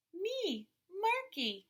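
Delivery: put-on high little-boy voice naming himself; proud toddler. A narrator's reading. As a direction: say it like a tiny child pointing at himself. Toddler says his name.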